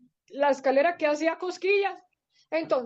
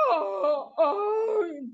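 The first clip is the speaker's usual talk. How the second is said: words pushed out through a big mock yawn; acted sleepy yawn; slower than usual. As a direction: yawn at length, then speak sleepily. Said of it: "dijo bostezando".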